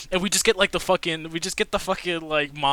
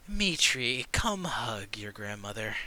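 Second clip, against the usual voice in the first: put-on sarcastic old woman; 4 semitones lower, 5 dB quieter, slower than usual.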